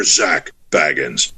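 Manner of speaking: low spirit voice from a recording; ghostly voice. Retold or imitated. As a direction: drop low and intone the name eerily. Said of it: "you hear the voice go".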